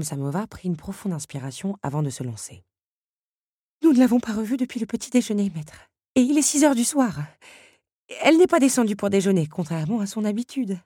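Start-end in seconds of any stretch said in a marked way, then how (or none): 3.8-10.8 high, loud, fast fretting; worried teapot. A narrator's reading. Madame Samovar telling the master Belle is missing, high and fast. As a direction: rush the worry out.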